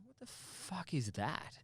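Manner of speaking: slow, hushed what-the-hell; startled. Retold or imitated. Driver startled by something in the headlights, hushed and slow.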